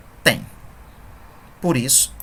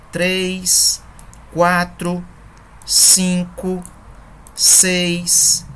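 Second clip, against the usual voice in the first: each syllable count said slowly and spaced; counting syllables aloud; 3 semitones higher, much slower than usual. Shown as a demonstration. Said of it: "vamos contar quantas sílabas nós temos". (contagem pausada das sílabas)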